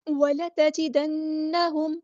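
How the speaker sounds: وَلَتَجِدَنَّهُمْ with the نّ held in ghunna one alif long; slow tajweed reading of وَلَتَجِدَنَّهُمْ; Quranic recitation. Shown as a demonstration. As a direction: chant وَلَتَجِدَنَّهُمْ slowly, humming through the doubled نّ. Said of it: "نون پہ تشدید ہے".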